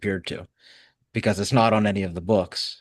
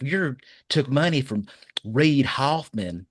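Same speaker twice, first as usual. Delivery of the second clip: high, drawn-out Southern impersonation; Chrisley; 6 semitones higher, slower than usual. Retold or imitated. Host parroting an official's accusation, Chrisley impersonation. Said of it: "That's my best, uh, Chrisley impersonation".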